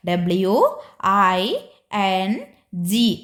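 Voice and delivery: ഡബ്ല്യു ഐ എൻ ജി spelt letter by letter, slowly; spelling out. Shown as a demonstration. Teacher models spelling for the children, slow. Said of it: "spelling പറഞ്ഞേ മക്കളെ".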